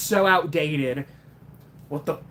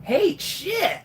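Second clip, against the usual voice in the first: much higher bright hey; chirpy cartoon greeting; Roger Craig Smith Sonic; 11 semitones higher than usual. Sonic impression greeting his friend Chip, much higher. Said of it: "Your Roger sounds a ton like Jason".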